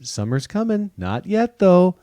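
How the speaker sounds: high, singsong teasing, slowing at the end; teasing spring voice. Pretend. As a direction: play spring toying with everyone. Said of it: "spring's like".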